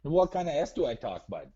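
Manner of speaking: lightly hammed-up Midwestern nice-guy voice; put-on Minnesota accent. Prank caller doing Minnesota nice to host, mild accent. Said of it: "Why are you talking like that?".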